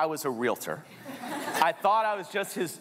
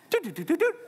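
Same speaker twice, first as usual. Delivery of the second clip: frantic dudes; 12 semitones higher, faster than usual. Retold or imitated. Frantic call to Tyler.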